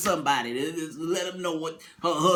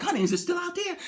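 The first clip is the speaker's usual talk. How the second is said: high, honeyed mock question; sweet wife voice; 11 semitones higher, faster than usual. Wife checking on her husband sweetly. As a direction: coo it like a patronizing wife. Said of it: "she was like".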